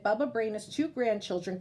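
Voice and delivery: plain reading voice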